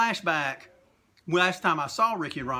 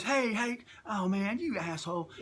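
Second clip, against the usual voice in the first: retold mock yelling; 5 semitones higher, 4 dB quieter than usual. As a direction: imitate someone yelling, without real volume. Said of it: "he starts yelling at me".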